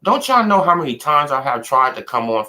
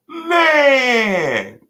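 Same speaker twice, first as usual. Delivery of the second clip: much higher, crying-shouting, drawn out in triumph; ecstatic half-crying yell; 13 semitones higher, 3 dB louder than usual. Real, not acted. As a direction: wail with joy like you can't believe it. Still wailing in triumph.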